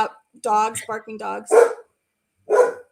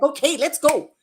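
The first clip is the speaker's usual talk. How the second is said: a lightly voiced, peppy upbeat cheer, a bit higher and louder; imitating the YouTuber; 4 semitones higher, 4 dB louder than usual. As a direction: mimic her cheery clap-along cue. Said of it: "It's like".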